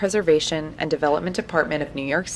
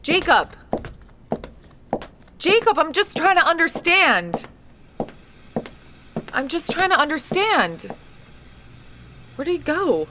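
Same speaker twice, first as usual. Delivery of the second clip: calling out; 12 semitones higher than usual. Acted. Reporter calling after fleeing Jacob, pleading shout. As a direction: shout after someone running off.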